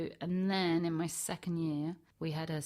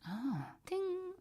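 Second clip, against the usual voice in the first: realization; 10 semitones higher than usual. Retold or imitated. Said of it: "And I was like".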